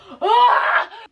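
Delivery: sharp high gasp, almost a cry; loud dramatic gasp. Acted. Telling viewers about her greasy hair ordeal, hammed-up gasp. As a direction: gasp as if in shock.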